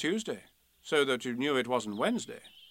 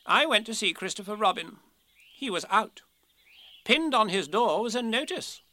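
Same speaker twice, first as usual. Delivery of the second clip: higher-pitched storybook Rabbit; raised, emphatic animal character voice; earnest Rabbit, solemnly reporting; 6 semitones higher than usual. Acted. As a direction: report the facts importantly as Rabbit.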